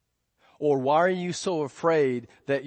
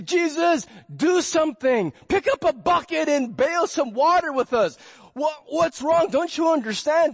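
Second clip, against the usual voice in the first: higher, louder mock panic; panicked pleading; 11 semitones higher, 5 dB louder than usual. Disciples panicking at sleeping Jesus, loud frantic plea. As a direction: beg him frantically to wake up and help.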